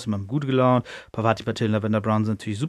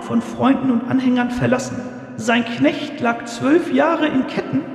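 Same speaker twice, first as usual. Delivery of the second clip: totally different tone; 12 semitones higher than usual. Retold or imitated. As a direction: intone it like a seer in a trance, pitched way up. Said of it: "in einem ganz anderen Tonfall, als sie sonst redet".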